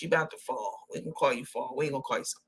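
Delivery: ordinary delivery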